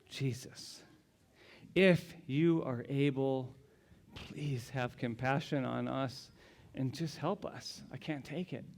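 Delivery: soft, slow, broken-down plea; desperate plea. Acted. Father begging Jesus for his son, soft broken plea. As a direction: beg softly with nothing left. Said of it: "gives that one last final plea".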